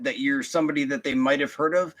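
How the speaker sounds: usual speaking voice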